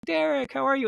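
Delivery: high-pitched, delighted hello; excited greeting. Retold or imitated. Redhead greets a musician she met two years before, excited. Retold surprise reunion with [name].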